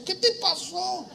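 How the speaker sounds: high, slow shocked wife; alarmed wife. Retold or imitated. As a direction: gasp it out in alarm. Wife sees bloodied husband. (esposa alarmada, muy aguda y lenta)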